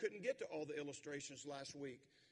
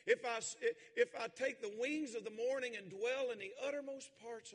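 full-force preaching; 6 semitones higher, 8 dB louder than usual. Acted. Preacher leading the congregation through the psalm at full force. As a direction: lift the church with the scripture.

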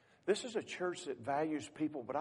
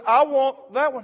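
put-on insistent boy; 12 semitones higher, 18 dB louder than usual.

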